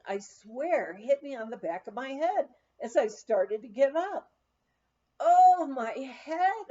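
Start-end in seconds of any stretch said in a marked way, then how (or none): 5.2-6.6 slow, loud pained groan. A narrator's reading. Groaning on waking with a hangover. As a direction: groan it out, head pounding. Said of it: "I groaned".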